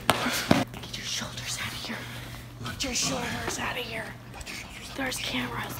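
hushed, urgent whisper; very soft secretive whisper. Real, not acted. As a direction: whisper so the cameras won't catch it. Furtive warning about cameras.

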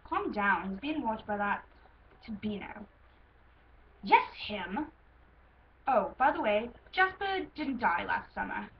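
lightly put-on British accent; acted dialogue with a touch of British; Luke. Stallion telling a parent about their son, light British accent. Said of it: "Luke I'm gonna do in a British accent".

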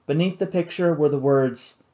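ordinary delivery

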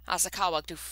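ordinary delivery, nothing marked

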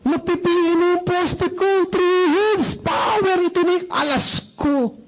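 high-pitched, overjoyed gushing; very excited. Acted. Networker thrilled about a prospect paying in tomorrow, very excited. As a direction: crow over a big win, voice way up. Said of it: "Because you're very, very excited".

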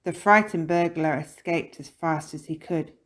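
usual speaking voice

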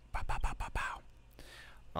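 soft unvoiced "pow pow pow"; quiet mouthed percussion noises; voiceless pow sounds. Real, not acted. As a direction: puff out idle little pows under the breath. Vocal filler while browsing tracks.

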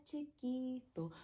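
soft little voice; small voice. Shown as a demonstration. Teacher showing chiquito in a soft, small voice. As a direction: drop to a tiny volume. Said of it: "big and small".

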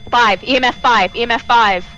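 loud, emphatic alert to teammates; urgent call-out. Real, not acted. Calling out ghost reading urgently.

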